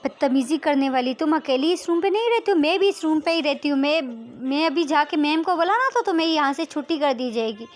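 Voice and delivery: heated quarrelling voice; angry confrontation. Acted. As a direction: scold the roommate with a threat. Radhika confronts roommate in quarrel.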